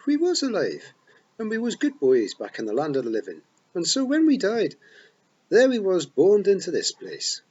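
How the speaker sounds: high, loud Scots pixie, full of pride; excited Feagle boast. A narrator's reading. Rob boasts to Tiffany about being born here, loud and proud. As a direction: wee warrior proudly telling his tale.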